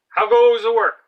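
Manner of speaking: high, loud call from the lazy farmer; bossy farmer calling. Acted. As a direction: bellow it like a lazy boss from bed. Farmer voiced.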